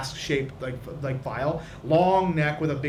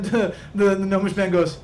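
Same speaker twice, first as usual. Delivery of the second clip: ordinary talk, nothing marked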